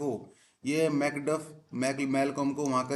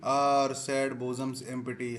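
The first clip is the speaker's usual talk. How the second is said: very slow reading; much slower than usual. Shown as a demonstration. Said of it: "teacher reads text slowly".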